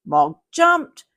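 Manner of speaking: ordinary delivery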